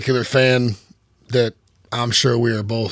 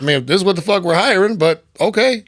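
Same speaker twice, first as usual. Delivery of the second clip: skeptical; 6 semitones higher than usual. Retold or imitated. Firefighter doubting a fake fireman's claim, sarcastic. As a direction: sound unconvinced. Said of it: "you go".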